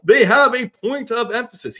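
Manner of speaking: high, booming play-by-play impression; Kevin Harlan. Podcaster retrying the play-by-play guy's voice, loud announcer delivery. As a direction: project it loudly like a game announcer. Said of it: "Kevin Harlan voice".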